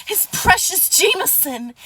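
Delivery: high, slow, exaggerated mock outrage; hammed-up, high-pitched sarcasm; mocking whine. Real, not acted. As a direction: wail the accusation sarcastically, dragging it out. Mocking the accusation against her.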